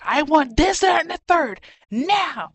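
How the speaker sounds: loud, high demanding customer; a rude customer yelling at the counter; retold customer yell. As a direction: bark the order at a cashier. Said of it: "yell it into my ear".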